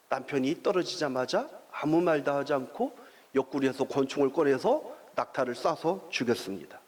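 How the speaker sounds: ordinary talk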